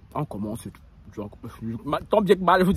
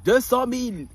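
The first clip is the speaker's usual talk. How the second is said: mock shout; 6 semitones higher, slower than usual. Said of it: "on criait".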